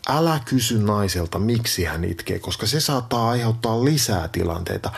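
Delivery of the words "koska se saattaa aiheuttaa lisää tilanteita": ordinary talk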